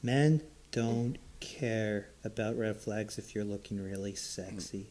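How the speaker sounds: slow, low and soft, in earnest; dead serious. Real, not acted. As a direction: state it gravely, like hard-won truth. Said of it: "Frank is talking serious".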